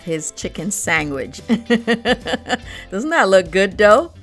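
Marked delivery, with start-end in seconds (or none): 1.5-4.1 slow, laughing tease. Real, not acted. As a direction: laugh while you ask it. Laughing at husband with sandwich.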